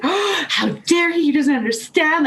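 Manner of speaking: offended. Retold or imitated. Saleswoman packing her bag after her boss sent her home, high tearful outrage. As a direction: wail it, wounded pride. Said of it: "I was freaking out".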